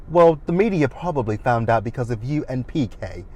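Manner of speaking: ordinary talk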